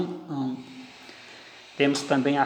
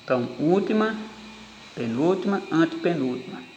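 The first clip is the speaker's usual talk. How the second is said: última, penúltima, antepenúltima raised, counted out; teacher counting the syllables back to the stressed one; 3 semitones higher, faster than usual. Shown as a demonstration. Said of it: "aqui está a tonicidade".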